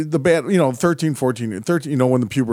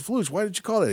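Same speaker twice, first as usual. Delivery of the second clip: higher-pitched mock protest; complaining kid; 9 semitones higher, 3 dB quieter than usual. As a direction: imitate a young player griping at the referee. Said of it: "and he goes".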